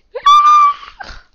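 piercing, loud high-pitched squeal. Shown as a demonstration. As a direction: shriek at the very top of your voice. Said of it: "My voice can go to very high altitudes".

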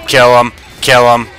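loud frantic urging; desperate pleading. Real, not acted. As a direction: beg them to kill it. Begging teammates to kill attacker.

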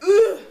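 high, loud exclaimed "ugh"; mock "ugh". Imitating Kenesha's reaction to a friend, loud mock ugh. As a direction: blurt a big, high ugh as an impression. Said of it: "Kenesha is like".

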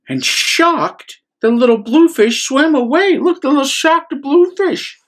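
high, mock-shocked voice; shocked fish. Reader acting out the little fish's shock, high and mock-shocked. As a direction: play up the shock for a child.

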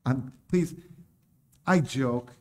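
usual speaking voice

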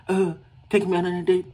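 mock the girls who only want dates; mocking demanding girls. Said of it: "they was like".

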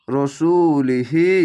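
রসুলিহি very slowly, ending হি held; slow chanted রসুলিহি; Quranic recitation. Shown as a demonstration. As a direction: draw out রসুলিহি. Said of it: "খারজের এক আলিফ টেনে পড়বেন".

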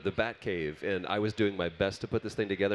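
ordinary talk, nothing marked

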